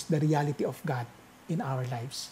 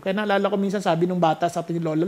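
ordinary delivery

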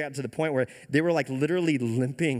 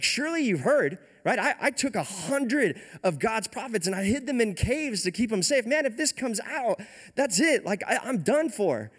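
high-pitched mock panic, not shouted; low-key freak-out; 8 semitones higher than usual. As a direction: plead your case like you're doomed. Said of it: "has a low-key freak-out moment".

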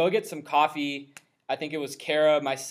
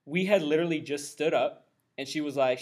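ordinary delivery

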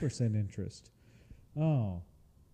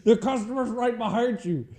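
laughing mock warning; 9 semitones higher, 5 dB louder than usual. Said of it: "coworker warning retold".

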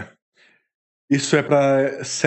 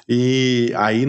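ordinary talk

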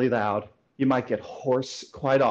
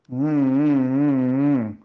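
hummed ng sounds, louder; ng sound demo; 4 dB louder, much slower than usual. Shown as a demonstration. Voice coach demonstrating the ng resonance exercise. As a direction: buzz the ng in the nose. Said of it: "I like to do is the N G sound".

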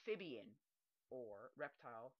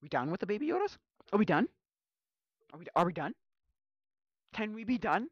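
mock exasperation; 7 semitones higher, 18 dB louder, faster than usual. Mock fed-up self.

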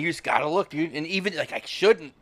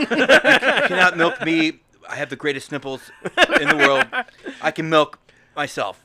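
Trump impression; 4 semitones higher, 6 dB louder than usual. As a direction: boast like Trump. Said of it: "That's kinda Trump".

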